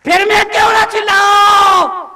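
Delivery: full-force pulpit shout; shouting preacher. Real, not acted. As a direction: roar it out to the whole hall. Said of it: "चिल्ला चिल्ला के कहता हूं".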